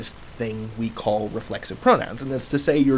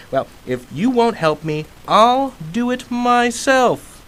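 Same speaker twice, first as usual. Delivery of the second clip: much higher and louder, slowing hard on the last words; storming off in a huff; 10 semitones higher, 6 dB louder, slower than usual. Shown as a demonstration. Teacher storming off in a huff, demoing, huffy and loud. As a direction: stomp off refusing help, stretching do it myself. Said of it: "imagine me storming off in a huff".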